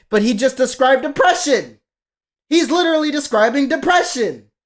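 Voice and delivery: loud, high-pitched insistence; exasperated. Real, not acted. Critic arguing back at the singer's fan base, exasperated and loud.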